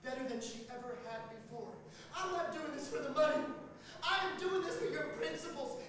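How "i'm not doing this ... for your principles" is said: high, loud and firm; Stacy calling across the water; shouted conviction. A narrator's reading. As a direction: shout it like a cause.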